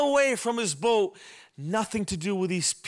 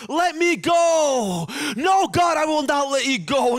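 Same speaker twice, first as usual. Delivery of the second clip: wrestling shout; 7 semitones higher, 6 dB louder than usual. Acted. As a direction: shout it as if grappling with God. Jacob wrestling with God.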